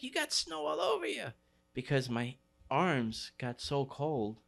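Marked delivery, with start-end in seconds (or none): 0.0-1.3 very high-pitched imitation of a mother; surprised mom. Friend's mom opening the door to him, high surprised mom voice. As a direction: squeal it like a startled mom.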